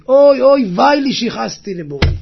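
imitated cry of remorse; acted crying out. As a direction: wail it as the retold sufferer. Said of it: "הוא התחיל לצעוק".

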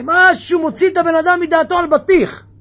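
loud, high outburst of disbelief; preacher shouting in exasperation; exasperated shout. Real, not acted. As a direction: shout it out, fed up. Preacher exasperated at melon buyer.

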